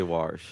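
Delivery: "warsh" with an R before sh; dialect pronunciation. Shown as a demonstration. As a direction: give wash its Baltimore R, "warsh". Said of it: "train myself to not say".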